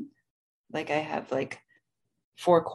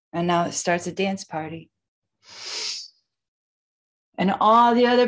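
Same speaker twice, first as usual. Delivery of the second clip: guided breathwork. Acted. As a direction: lead the breathing gently. Guides a box breathing session.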